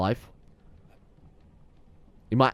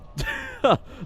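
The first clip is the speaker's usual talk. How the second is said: sudden high, squeaky burst of laughter; high-pitched laugh. Real, not acted. Gamer reacting to bonfire placement, high incredulous laugh.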